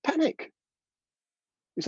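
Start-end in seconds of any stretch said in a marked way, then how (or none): 0.0-0.5 high squeaky panic cry; mock panic. Shown as a demonstration. Teacher voices the body's message, high mock panic. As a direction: squeal the word like your body's alarm. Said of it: "You're telling your body".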